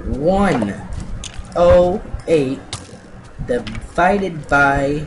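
slow, loud, word-by-word sum; deliberate counting-out. Real, not acted. Gamer doing the maths together with viewers, slowly and loudly. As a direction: spell out each word with heavy pauses.

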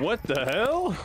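high, startled what the hell; sudden alarmed outburst; shocked. Real, not acted. Shocked at sudden boss fight.

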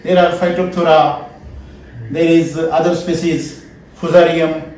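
ordinary talk

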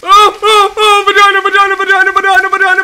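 very high, loud repeated chant; frantic yelling. Real, not acted. Player who can't do this anymore, frantic high yelling. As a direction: screech it over and over.

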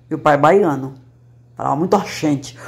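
ordinary talk

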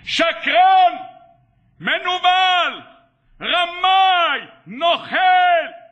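high, long drawn-out accusing shouts; acted furious yelling at a distance; shouting from afar. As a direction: hurl each insult across the street, stretching it out. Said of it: "הוא כבר צועק לו מרחוק".

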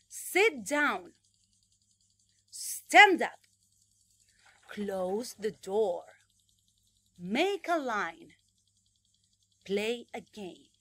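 loud, slow, drawn-out commands; over-articulate for kids to repeat; repeat-after-me classroom commands; exaggerated teacher model. Shown as a demonstration. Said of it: "exagerado para pronunciar".

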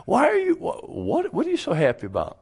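high, fast puzzled voice; mock puzzled outsider.

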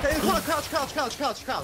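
high panicked repeated callout; urgent high-pitched orders; panicking gamer. Real, not acted. Frantic callout to teammate.

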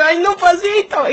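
high, slow half-crying whimper; mock-crying to her lover to slow down; whimpering plea. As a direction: whine it out like it hurts.

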